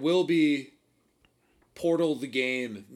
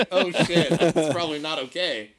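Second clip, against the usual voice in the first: louder, startled oh shit; mock alarmed realization; 7 dB louder than usual. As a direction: catch yourself, suddenly alarmed. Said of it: "I'm just like".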